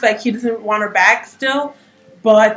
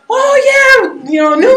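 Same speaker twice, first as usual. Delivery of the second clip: retold excited cheer; 12 semitones higher, slower than usual. Hyping up the theater crowd, high excited yell. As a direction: pump up the crowd. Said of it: "I was like".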